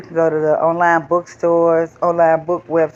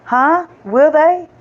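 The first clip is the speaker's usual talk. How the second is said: higher puzzled question; high doubtful huh; wondering huh; 11 semitones higher, 4 dB louder, slower than usual. Acted. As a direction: ask the question aloud, unsure. Character wonders.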